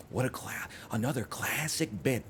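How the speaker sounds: soft, put-on villain voice; Joker. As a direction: savour it like a theatrical villain. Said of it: "that was more of a Joker impression".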